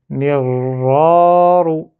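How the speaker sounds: "mirra, ro" joined, long loud a before "ro"; lu d'un coup. Shown as a demonstration. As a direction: glide from a stretched "mirra" straight into "ro". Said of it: "je lève cette dernière barrière". (« mirra, ro » lié, a prolongé)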